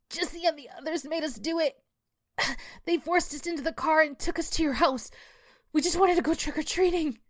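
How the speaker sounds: put-on high-pitched pleading girl; whiny, high plea for mercy; begging. A narrator's reading. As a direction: beg desperately in a high child voice. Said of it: "she begged".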